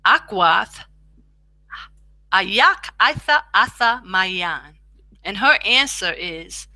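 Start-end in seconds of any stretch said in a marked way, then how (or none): 2.3-4.8 slow demo. Shown as a demonstration. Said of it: "You can speak it out".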